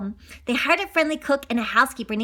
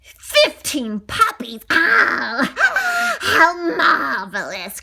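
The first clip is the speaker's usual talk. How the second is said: Cruella's cry; 3 semitones higher, 4 dB louder, much slower than usual. Acted. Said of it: "cried Cruella".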